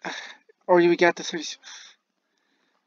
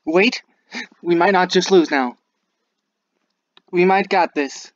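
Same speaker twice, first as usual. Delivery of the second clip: suddenly hopeful, higher and louder; excited; 5 semitones higher, 7 dB louder than usual. Real, not acted. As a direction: realise you can still win. Sudden comeback hope.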